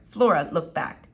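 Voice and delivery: usual speaking voice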